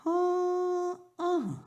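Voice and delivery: high, drawn-out sing-song denial; falsetto mother. Acted. Mother promising her son no spanking, high sing-song. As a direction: fake innocence, very high.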